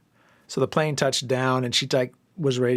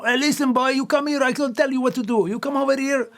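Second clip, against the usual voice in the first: put-on much higher, louder father in a thick Marseille French accent; heavy Marseille accent; 13 semitones higher, 5 dB louder than usual. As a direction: play dad in a thick Marseille French accent, bossy but kind. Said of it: "he used to have a heavy Marseille accent".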